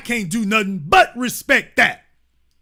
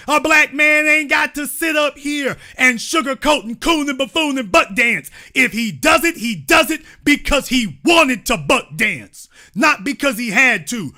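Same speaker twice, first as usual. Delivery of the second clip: loud, raised preaching rant; impassioned rant; 4 semitones higher, 3 dB louder than usual. Real, not acted. Host preaching to viewers, loud rant. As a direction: hammer the point home.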